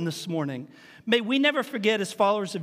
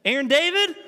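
put-on higher-pitched mom calling her child down; raised, rising motherly summons; mama calling upstairs; 6 semitones higher, 4 dB louder than usual. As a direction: call out the name as a warning from mama. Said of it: "if your mama today, uh, were to call you down".

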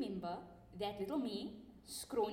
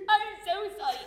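panicked apology; 8 semitones higher, 9 dB louder than usual. Retold or imitated. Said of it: "gasping for air".

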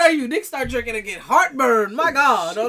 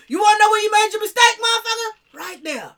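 mock confrontation; 7 semitones higher than usual.

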